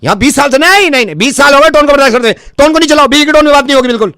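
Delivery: high-pitched indignant tirade; outraged rant. Acted. Technician threatens to rip out Wi-Fi, outraged rant. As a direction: rant as if twenty years of patience ran out. Prank technician rants at customer.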